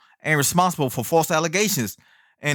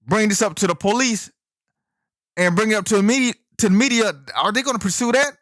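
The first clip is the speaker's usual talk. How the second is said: high-pitched, incredulous challenge to the listeners; worked-up, disbelieving opening tirade; indignant rant; 6 semitones higher than usual. Real, not acted. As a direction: rant in raised pitch, demanding they see the lunacy. Opening rant against believing all women.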